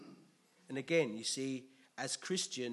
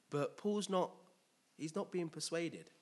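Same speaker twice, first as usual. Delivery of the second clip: ordinary talk, nothing marked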